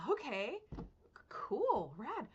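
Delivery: taken-aback little string of approvals; retold reaction. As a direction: react with a halting, high-pitched okay, cool. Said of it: "And I was like".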